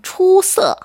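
초 held high and level, 서 falling sharply; first-then-fourth tone. Shown as a demonstration. Chinese teacher showing how to pronounce the word, tone demo. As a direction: model 초서 slowly with high 초, dropping 서. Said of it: "사성으로 발음해 주세요".

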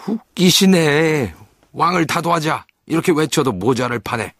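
loud, high-pitched scoff; mock them, drawing out the first word; scornful pirate. Hook scoffs at loyal boys, loud scorn.

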